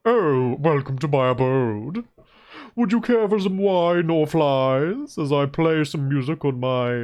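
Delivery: cultured frog host. Acted. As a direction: welcome guests like a fancy gentleman frog. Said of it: "He's like".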